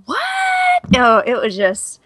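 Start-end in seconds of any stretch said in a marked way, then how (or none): 0.0-0.9 very high, drawn-out incredulous question; mock disbelief. Podcaster reacting to ridiculous book excerpts, high mock disbelief. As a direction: squeak it in exaggerated bafflement.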